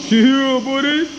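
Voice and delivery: loud high hype cry; excited shout. Real, not acted. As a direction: holler it like a cheer.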